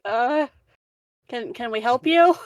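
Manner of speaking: hesitant, mildly puzzled question; confused guards. Acted. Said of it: "they're like".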